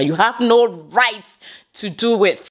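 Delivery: ordinary delivery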